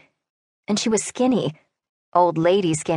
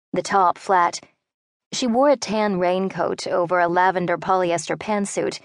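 usual speaking voice

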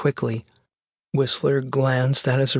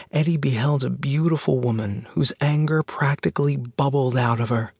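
usual speaking voice

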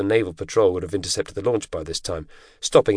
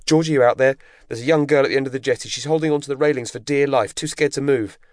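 high, urgent radio call; alarmed, pressing plea for help; panicking; 6 semitones higher than usual. A narrator's reading. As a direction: raise the pitch and report it urgently. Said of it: "panicking as she fumbled".

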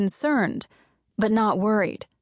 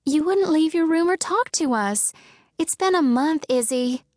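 higher, pleading sister voice; 7 semitones higher than usual. Acted. Sister begging Izzy to come out, higher and pleading. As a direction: coax her out gently.

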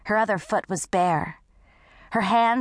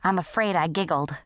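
plain reading voice

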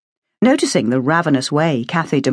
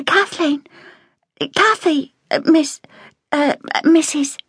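put-on higher, hesitant child voice; shy little girl; 12 semitones higher than usual. A narrator's reading. Girl unsure how to address the cook, hesitantly. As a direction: stumble over what to call her.

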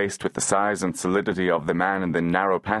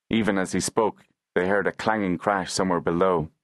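ordinary delivery

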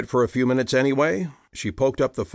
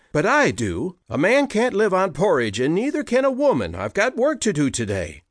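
higher, rising, snappy comeback; retorting sharply; 7 semitones higher than usual. A narrator's reading. Hannah snapping back at Peter, a bit higher and quicker. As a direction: tell him off, quickening as you go. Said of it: "she shot back".

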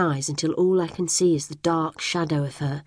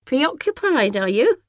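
put-on high, fluttery old lady voice; fussy neighbour; 9 semitones higher than usual. A narrator's reading. Old neighbour greeting the twins in a high, gushing voice. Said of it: "says Missus Dunkerley".

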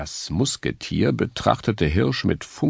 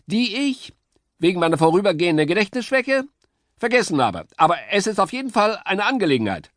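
fast, chirpy know-it-all; high bird chatter; very high eager woodpecker; 13 semitones higher, faster than usual. A narrator's reading. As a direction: rush to explain.

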